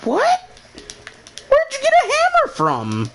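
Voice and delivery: loud high falsetto shock; squeaky disbelief. Retold or imitated. Asking his friend where the hammer came from, loud falsetto disbelief. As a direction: shriek the question in a squeaky falsetto. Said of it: "And I'm like".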